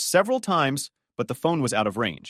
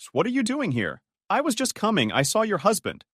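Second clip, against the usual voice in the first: lightly voiced surprised woman, higher; a mild startled rise at seeing him; 7 semitones higher than usual. A narrator's reading. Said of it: "surprised Camille".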